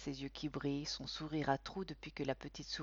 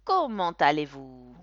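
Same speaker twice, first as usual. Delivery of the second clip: slow, loud clown question; a lightly voiced entertainer; clown asking; 2 semitones lower, 10 dB louder than usual. A narrator's reading. Clown addresses the children. (clown lent et sonore)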